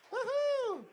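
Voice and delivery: high, loud woohoo; host whooping to send off the comic; whooping cheer. Real, not acted. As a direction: whoop the crowd up. Host cheering after a set.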